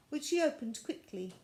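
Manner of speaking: usual speaking voice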